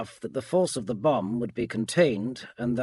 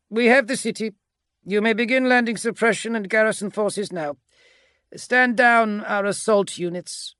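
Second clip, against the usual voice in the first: higher, raised victorious report; grinning commander; 10 semitones higher, 4 dB louder than usual. A narrator's reading. Agapito voxes his lieutenant that landings may begin, grinning. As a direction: report success with a grin. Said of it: "he grinned".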